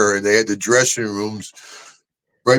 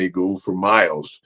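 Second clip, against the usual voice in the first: normal talking voice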